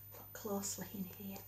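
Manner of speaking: hushed, barely voiced aside; whisper. Real, not acted. Reader pointing at the picture for children, whispering. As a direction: lean in and whisper it.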